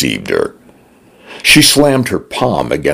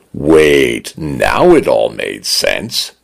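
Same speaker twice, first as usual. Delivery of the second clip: ordinary delivery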